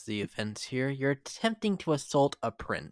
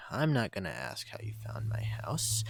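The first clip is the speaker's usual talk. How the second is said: disdainful whisper; 5 semitones lower, 7 dB quieter than usual. Acted. Sneering at unwanted visitor.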